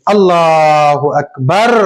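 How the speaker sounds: slow, drawn-out takbir going into ruku; prayer takbir. Shown as a demonstration. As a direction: intone it like the imam. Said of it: "उसके बाद हम रुकू में जाएंगे".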